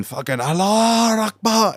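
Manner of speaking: high, slow mock chant; imitating protester.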